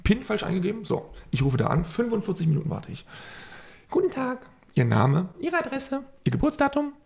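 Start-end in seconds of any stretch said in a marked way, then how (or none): 3.9-6.9 imitating a bank hotline clerk; hotline agent voice. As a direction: rattle off questions like a call agent.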